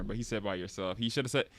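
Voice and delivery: usual speaking voice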